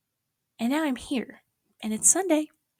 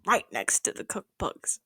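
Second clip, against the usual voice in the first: slow whispered repeat; hushed repeat; 3 dB quieter than usual. Real, not acted. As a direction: say it again under breath. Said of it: "repeat whispered".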